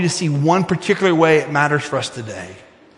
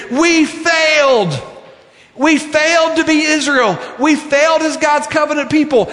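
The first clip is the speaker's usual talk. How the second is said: anguished confession; 10 semitones higher than usual. Acted. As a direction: cry it out as a whole people admitting failure. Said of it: "go see that great final prophet and say".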